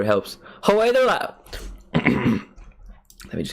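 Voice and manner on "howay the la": high Geordie cry, cut short; small-mouthed Geordie attempt. Shown as a demonstration. Streamer testing a tip, high small-mouthed Geordie attempt. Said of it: "Make your mouth smaller".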